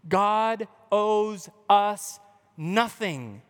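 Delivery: very slow, each word weighted; solemn drawn-out proclamation; slow emphatic declaration. Real, not acted. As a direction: let God owes us nothing land hard. Sermon key line, slowed.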